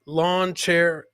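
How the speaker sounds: ordinary delivery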